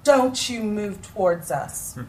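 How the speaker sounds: loud, firm, slow command; ordering the attacker to back off; commanding shout. Retold or imitated. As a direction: stop him cold with your voice. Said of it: "I turned around and I said".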